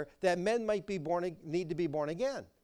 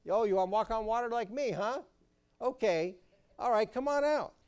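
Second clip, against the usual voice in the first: higher, fast teasing dare; a playful mock of a taunting Lord; mocking Jesus voice; 6 semitones higher, 3 dB louder than usual. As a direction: taunt him like a cocky friend. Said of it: "I might have had the tendency to say".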